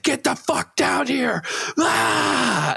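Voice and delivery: high, slow yelled threat with a cry; retold scream. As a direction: scream it at the neighbor from the street. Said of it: "I started screaming like a gentleman".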